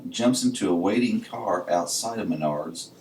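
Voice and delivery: ordinary delivery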